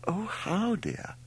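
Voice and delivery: intrigued mother, starting very high and falling away. A narrator's reading. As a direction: swoop up high, then drop softly. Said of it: "very intrigued".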